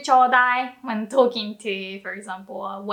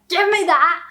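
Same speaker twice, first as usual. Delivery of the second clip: loud, higher-pitched rude order; rude demand; 6 semitones higher, 7 dB louder than usual. Shown as a demonstration. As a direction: demand it forcefully, with no courtesy. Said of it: "if you wanna sound rude".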